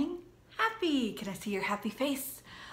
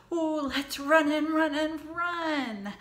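excited; 6 semitones higher, 7 dB louder, slower than usual. Acted. Excited pumpkin voiced high.

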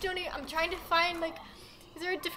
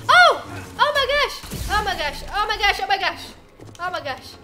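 panicked shrieks; 3 semitones higher, 11 dB louder than usual. Real, not acted. Gamer shrieking as spiders attack her.

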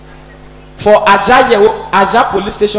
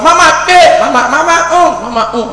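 mocking robbers; 9 semitones higher, 3 dB louder than usual. Robbers harass old woman.

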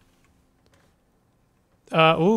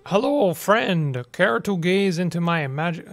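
slow, drawn-out vampire greeting; spooky vampire; slower than usual. Acted. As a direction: stretch the hello like a creepy count. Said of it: "That was very spooky".

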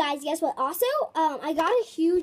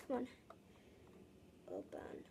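soft unvoiced whisper; 22 dB quieter than usual. Real, not acted. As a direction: whisper at the box. Whispering to coin box.

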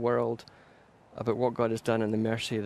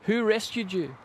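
crowd yelling out; 9 semitones higher than usual. Acted. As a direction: call it out high like an onlooker, without full volume. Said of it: "the crowd would yell out".

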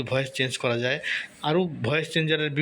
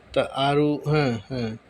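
normal talking voice

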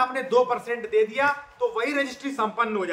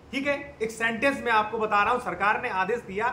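ordinary delivery

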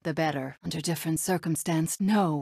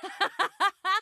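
high, pealing victory laugh; delighted high laughter; triumphant laugh; 11 semitones higher than usual. Acted.

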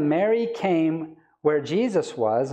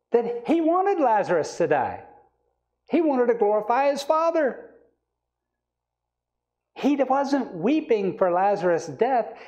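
higher-pitched, insistent sermon voice; impassioned preacher's rebuttal, pitched up; emphatic preaching; 7 semitones higher, 3 dB louder than usual. Real, not acted. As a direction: drive the correction home from the pulpit.

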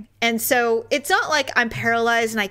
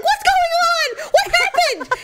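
alarmed driver; 4 dB louder than usual. Retold or imitated. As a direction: imitate a panicked demand for answers. Imitates alarmed driver swerving.

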